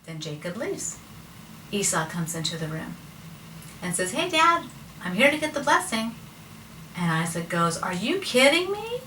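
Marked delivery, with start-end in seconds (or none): 4.1-6.1 lightly acted eager son, pitched up; eager greeting. Esau arrives expecting the blessing. 7.8-9.0 high, incredulous rising question; playful retold outrage; mock disbelief. Isaac's shocked reply, retold.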